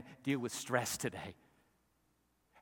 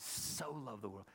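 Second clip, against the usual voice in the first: SO drawn out softly; hushed, lingering on "so"; stress on SO; 12 dB quieter than usual. Shown as a demonstration. Preacher savouring how descriptive the word is, SO softly stretched. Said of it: "How descriptive that word is".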